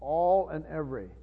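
"All" and "every" repeated softly, each weighted; quiet, deliberate weight on "All" and "every"; stress on all, every. Real, not acted. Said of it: "I wanna emphasize the word".

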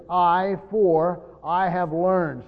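loud, deliberate "I", "for", then "I" stressed; emphatic I. Shown as a demonstration. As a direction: punch each "I" and slow down through "have learned". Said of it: "is very emphatic".

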